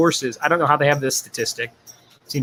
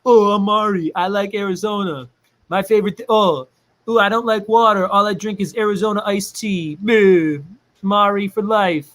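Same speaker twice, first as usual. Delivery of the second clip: higher mock Mari rambling; a higher playful impression of Mari; 5 semitones higher than usual. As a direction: tease her by playing her. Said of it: "Love the voices".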